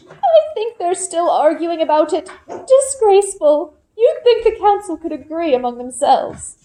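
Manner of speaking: put-on gossiping old woman tutting at the council; fussy old woman. Elderly Olga voiced.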